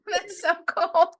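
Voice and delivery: high laugh through the words. Real, not acted. Host laughing at the guest's radio story, delighted.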